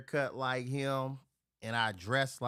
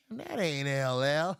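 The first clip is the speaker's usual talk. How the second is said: slow, louder disappointed "ain't"; slow letdown realization; 5 semitones higher, 5 dB louder, slower than usual. Retold or imitated. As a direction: realize the mistake out loud. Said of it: "we go".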